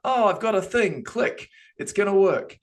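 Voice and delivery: naive mock enthusiasm. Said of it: "It isn't just a".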